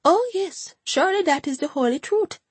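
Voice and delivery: high, anxious Jamaican agreement; anxious Hortense. A narrator's reading. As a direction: hurry to agree, high and nervous. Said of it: "said Hortense anxiously".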